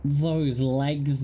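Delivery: usual speaking voice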